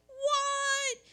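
high, amazed "What?"; a crowd of kids gasping; astonished kids. Retold or imitated. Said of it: "all the kids around are like".

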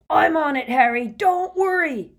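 big booming shout; yelled. Acted. Hagrid yelling to reassure Harry mid-chase, loud booming shout. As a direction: bellow it over the rushing wind. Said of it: "Hagrid yelled".

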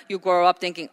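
normal talking voice

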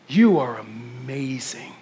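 low, slow and soft; tender reassurance. Real, not acted. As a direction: let it sink in, hushed and deliberate.

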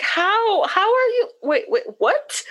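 very high, halting bafflement; confused disbelief. Retold or imitated. Wife reacting to husband playing the victim, confused disbelief.

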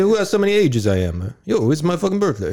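high, hammed-up Italian dad; mock Italian dad. Friend imitating Peter's dad, hammed-up Italian rant. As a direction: squawk it like a fed-up old dad.

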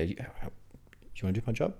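a soft unvoiced whisper; mock whisper. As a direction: whisper it teasingly to the player. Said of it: "Yeah, less accents".

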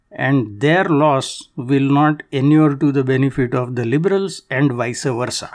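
ordinary delivery

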